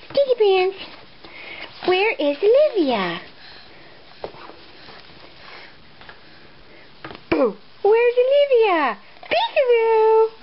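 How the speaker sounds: put-on high sing-song baby talk. Peekaboo with baby.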